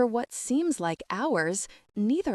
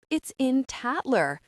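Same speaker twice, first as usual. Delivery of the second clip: higher, heated and cross; furious; 6 semitones higher than usual. A narrator's reading. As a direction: snap it out in anger. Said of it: "I say furiously".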